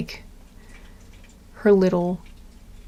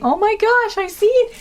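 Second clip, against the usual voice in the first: excited; 10 semitones higher, 6 dB louder than usual. Real, not acted. Spots ladybug, sudden excitement.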